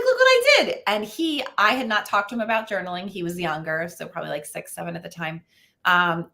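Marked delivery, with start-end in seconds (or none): 0.0-0.8 put-on much higher and louder, chirpy little boy; a beaming child bragging to mom; proud kid voice. Son voiced showing journal.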